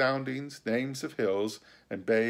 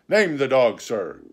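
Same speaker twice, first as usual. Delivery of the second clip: louder, insistent doctor; doctor's demand; 3 semitones higher, 9 dB louder than usual. A narrator's reading. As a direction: demand a name. Doctor answers squire.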